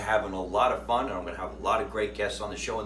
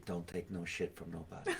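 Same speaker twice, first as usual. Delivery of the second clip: much softer and lower, with a laugh; low aside; 5 semitones lower, 13 dB quieter than usual. Shown as a demonstration. Host previews his sign-off line for the guest, low and quiet. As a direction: say the catchphrase under your breath.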